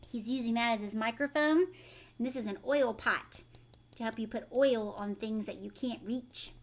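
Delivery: usual speaking voice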